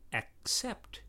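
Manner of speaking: "accept" split slowly as ak-SEPT; K S sound. Shown as a demonstration. Examples of double C words, slow K S sound. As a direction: separate the k and s in "accept". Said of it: "are pronounced with a K S".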